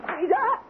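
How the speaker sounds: a sharp cry as she is hit; wife crying out as her husband strikes her; pained cry. Acted. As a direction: cry out from the blow.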